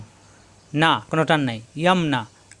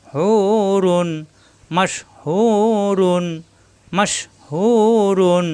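slow Quranic chant of মাশহুরুন; slow drawn-out recitation; 2 semitones higher, much slower than usual. Shown as a demonstration. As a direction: draw out মাশহুরুন, lengthening হু. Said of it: "একালিপ টান".

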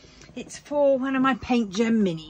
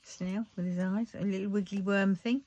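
ordinary delivery

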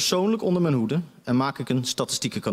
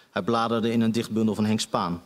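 plain reading voice

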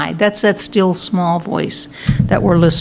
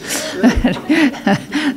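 short laugh; 5 semitones higher, 4 dB quieter than usual. Real, not acted. Laughs at [name] wig joke.

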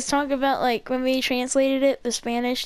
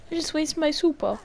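normal talking voice

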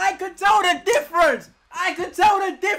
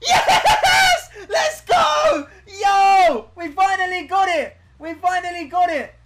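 real loud elation; ecstatic shouting; 4 dB louder, slower than usual. Real, not acted. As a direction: yell like you finally won. Celebrating catching a shiny.

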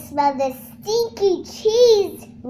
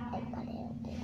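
whispering; 19 dB quieter, faster than usual. Real, not acted.

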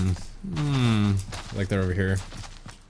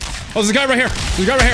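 high, sudden alarm; startled callout; 9 semitones higher, 4 dB louder, faster than usual. Real, not acted. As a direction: jump at the enemy. Enemy suddenly appears close.

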